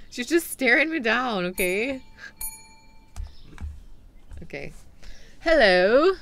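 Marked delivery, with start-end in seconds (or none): 5.4-6.1 hammed-up British greeting, swooping up loudly; loud mock-British hail with a rising lilt; British accent. Shopkeeper greeting a butler-like customer, playful British accent. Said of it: "work on my British accent".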